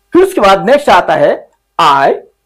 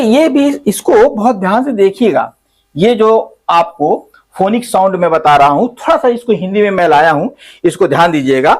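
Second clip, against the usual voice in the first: usual speaking voice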